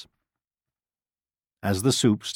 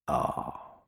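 low, soft sighed "ah"; wolf's sigh; 7 semitones lower, 7 dB quieter than usual. A narrator's reading. Wolf poking the stone with knife, sighing. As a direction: let out a quiet, low sigh. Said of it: "he sighed".

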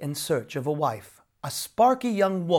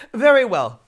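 laughing; 7 semitones higher, 12 dB louder than usual. A narrator's reading. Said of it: "laughed Jamie".

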